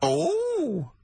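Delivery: very high, hammed-up comic cry; Dirty Old Queer. New comic character announced to the hosts in a very high, hammed-up voice. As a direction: squeal it as a silly caricature. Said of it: "he's a new character to me".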